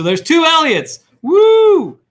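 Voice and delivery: rising loud into a high whoop; building to a high, loud whoop; playful cheer. Real, not acted. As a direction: cheer it, topping out in a long "woo". Mock celebration of duplicate names.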